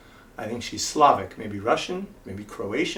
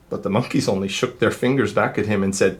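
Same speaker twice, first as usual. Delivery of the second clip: lively, sing-song storybook reading; reading to children; 3 semitones higher, 6 dB louder than usual. A narrator's reading. Reader telling kids the peddler story, animated. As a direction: read it like to a class of little ones. Reads picture book aloud.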